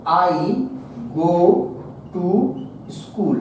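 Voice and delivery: slow, broken-up writing-along pace; word-by-word dictation. Shown as a demonstration. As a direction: dictate with long gaps between words. (शब्द-दर-शब्द रुककर लिखवाते हुए)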